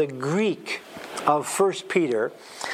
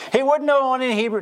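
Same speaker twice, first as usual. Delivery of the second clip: critics' objection; 7 semitones higher, 4 dB louder, much faster than usual. Retold or imitated. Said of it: "they'll say".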